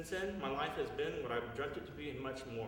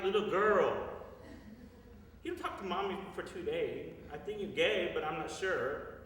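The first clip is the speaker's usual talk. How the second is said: higher, louder, lightly voiced mother with a laugh at the end; playing mom teasing him gently, higher and louder; imitating his mother; 7 semitones higher, 5 dB louder than usual. Son voices mother's reply.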